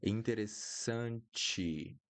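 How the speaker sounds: interessante slow, final te as chi; slow down and land interessante on a chi sound; ending ti as chi. Shown as a demonstration. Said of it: "pay attention to the pronunciation that I'm doing at the end".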